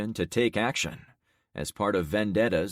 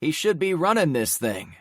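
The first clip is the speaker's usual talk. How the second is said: needling; 9 semitones higher, 5 dB louder than usual. Acted. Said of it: "needled her".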